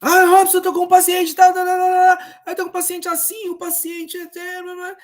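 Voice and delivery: high, loud mock doctor babbling at Robson. Social worker mimics doctors dumping patients on him, high and loud. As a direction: ham up a pushy doctor's rush of words. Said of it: "os médicos vêm".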